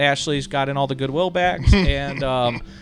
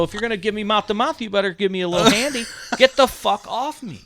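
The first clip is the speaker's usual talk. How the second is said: higher, louder, fast joking yell; mock yell; 9 semitones higher, 5 dB louder, faster than usual. To the lifeguard on the sand, a fast loud mock yell. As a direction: rattle it off as a loud joke. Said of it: "And I go".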